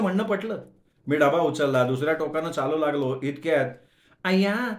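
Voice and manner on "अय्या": higher, squealed ayya; a young girl spots her teacher; girlish surprise. Acted. College girl spots her professor, higher surprised squeal. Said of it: "ओळखीचा आवाज कानावर आला".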